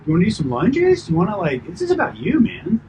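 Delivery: high, eager rising tone; party host voice. Shown as a demonstration. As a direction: play the over-friendly host. Continuing the party-host demo.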